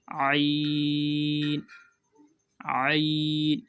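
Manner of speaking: आइन drawn long from the throat; throaty ain. Shown as a demonstration. Teacher demos a throaty आइन for students. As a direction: squeeze आइन out of the throat slowly. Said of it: "गला में से निकलेगी दोस्तों".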